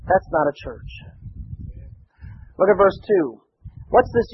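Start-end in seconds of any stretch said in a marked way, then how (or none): 0.1-0.9 dropped low and soft, sinking to a hush; hushed, grave repeat; quiet repeated verdict. Acted. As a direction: let it fall quiet and heavy.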